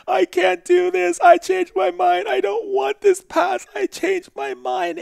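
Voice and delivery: very high, loud, panicked pleading; terrified screaming. Retold or imitated. Dying man begging the voice to stop, terrified high screams. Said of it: "I was screaming in my head".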